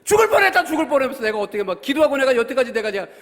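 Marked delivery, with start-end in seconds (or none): 0.0-1.2 shouted, much higher and louder; retold angry shouting. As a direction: bellow it as an exasperated father. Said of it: "갑자기 소리소리 지르고". (폭설에 지친 아빠의 버럭 고함)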